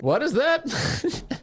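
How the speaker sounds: slow, drawn-out baffled question. Real, not acted. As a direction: stretch it out in disbelief. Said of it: "I don't think that's his accent".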